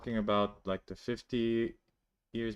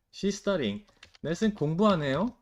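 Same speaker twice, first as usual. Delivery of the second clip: surprised tone; 7 semitones higher, 5 dB louder, much faster than usual. Shown as a demonstration. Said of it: "하네요 makes it this tone of".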